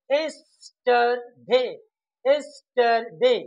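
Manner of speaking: ইয়েস্টারডে in three separated beats; broken into syllables. Shown as a demonstration. Teacher showing students syllables, ইয়েস্টারডে divided up. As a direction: sound out ইয়েস, টার, ডে with gaps. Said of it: "একসাথে উচ্চারণ করা যায়".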